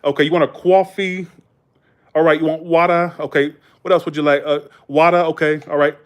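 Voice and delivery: hammed-up Boston order-taker; dropped R's, thick Boston vowels; Massachusetts accent. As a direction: imitate a New England drive-through accent. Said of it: "they talk like this".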